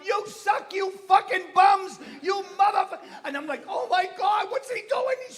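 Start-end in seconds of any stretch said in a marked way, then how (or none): 0.0-3.0 high, very loud heckling; furious fan screaming abuse at the team; angry yelling. Retold or imitated. Drunk-acting driver yelling at losing team with kids around, angry. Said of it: "He's yelling with all kids around". 3.7-5.1 high, loud, appalled exclamation; horrified outcry. Retold or imitated. Comic reacting to his driver screaming in the stadium, horrified.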